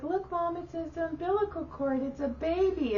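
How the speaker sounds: put-on high, soft excited kid voice; naive child. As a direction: point it out innocently to mom. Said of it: "in my stupid mind".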